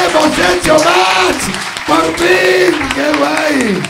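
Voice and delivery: loud, high, drawn-out cheer; triumphant shout. Real, not acted. Host announcing a match at last, triumphant shout. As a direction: celebrate it like a long-awaited win.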